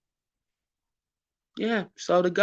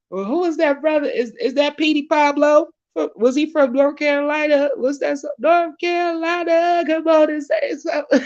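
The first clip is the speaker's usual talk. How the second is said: excited shout-out; 12 semitones higher, 8 dB louder than usual. Real, not acted. Hyped shout-out, calling [name].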